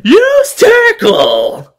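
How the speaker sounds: high, slow, drawn-out battle yell; screaming an attack. Retold or imitated. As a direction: scream the attack name at the top of your lungs. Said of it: "scream out every other attack".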